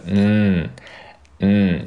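"嗯" as a held n, tongue tip on the palate; isolated n-sound demo on "嗯"; front nasal. Shown as a demonstration. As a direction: hum "嗯" through the nose as an English n. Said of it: "把舌尖顶到这个上硬腭的位置".

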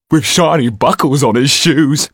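rat-like thug gloating to his partner, leering. Acted. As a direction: relish the prey like a sneaky rat. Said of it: "leered the rat-like creature".

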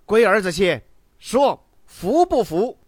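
loud, high, drawn-out demand; domineering shouted challenge; challenging shout. A narrator's reading. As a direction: bellow at the crowd to submit. New boss challenging the cowed beggars.

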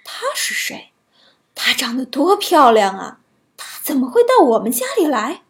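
surprised whispering questions; wide-eyed wonder, hushed; amazed dwarfs. A narrator's reading. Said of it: "他们惊奇地问".

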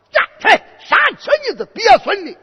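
high, shouted curse at a horse; acted furious shout; angry command. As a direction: bark the order high and loud. Cursing the fallen horse to rise.

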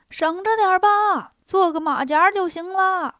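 teasing shout with long line endings; cheeky calling out. Acted. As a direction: call it across in a high singsong. Mock advice called to the immortal.

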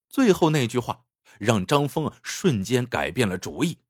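usual speaking voice

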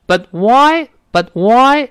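"but why" slow, split; but why with a break. Shown as a demonstration. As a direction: say "But why" slowly with "but" unreleased. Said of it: "这么说".

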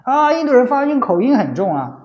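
high, mocking voice of a scornful critic; mimicking a snide compatriot; mocking sneer. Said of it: "中国人还嘲笑印度人".

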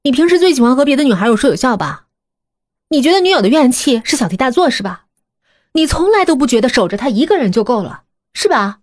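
rapid-fire mocking jabs; taunting. Qingqing keeps needling Adi.

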